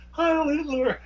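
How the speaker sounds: very high, thin-voiced imitation of a woman; high mock villainess. Woman saluting as Hitler speaks, high mock voice. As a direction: squeal the salute in falsetto. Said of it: "As Hitler's speaking, she's like".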